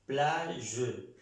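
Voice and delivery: "Plage" stretched, with a lingering zh at the end; "Plage" drawn out for learners, soft final G; French soft G. Shown as a demonstration. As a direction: model "Plage" slowly, G as zh, no full E. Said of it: "No estoy pronunciando la G con la E".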